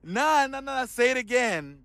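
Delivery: high, thrilled shrieks of delight; excited squeal. Real, not acted. Friend hears his buddy's savage comeback, squeals high with delight. Reacts to friend's bold line.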